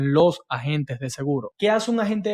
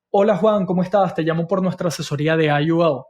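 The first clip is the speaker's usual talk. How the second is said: salesy agent voice; faster than usual. Shown as a demonstration. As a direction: play the pushy insurance agent on the phone. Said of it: "como lo haría un agente de seguros".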